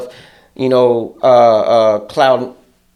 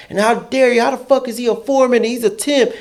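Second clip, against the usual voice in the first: mock outraged coworker; 10 semitones higher than usual. Coworker's angry quote.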